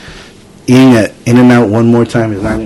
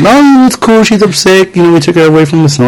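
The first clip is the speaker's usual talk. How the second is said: lightly mocking high, airy voice, quick at the end; high put-on voice; 8 semitones higher than usual. Voicing a relaxed adult about the upset child, high. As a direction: play it up high and breezy.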